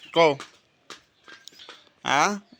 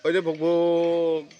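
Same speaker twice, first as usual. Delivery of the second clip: put-on dog sound for toddler; much slower than usual.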